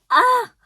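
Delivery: loud, high squeal of delight; overwhelmed high exclamation; swooning "ah!". Retold or imitated. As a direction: let out a giddy cry. Overwhelmed retelling making out.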